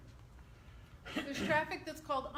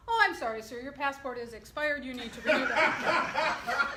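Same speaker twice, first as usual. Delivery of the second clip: laughing punchline; 2 semitones higher, 9 dB louder than usual. Retold or imitated. Teller voices the clerk's last line, laughing loudly. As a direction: deliver the joke while cracking up.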